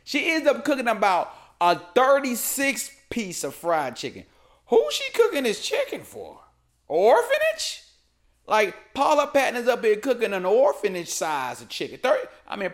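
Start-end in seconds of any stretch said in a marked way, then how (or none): 4.7-7.8 high-pitched disbelief, rising to a squeal; incredulous squeak. Real, not acted. Comedian to viewers, squealing in mock disbelief. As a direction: squeak it like you can't believe the pile. Incredulous joke about big batch.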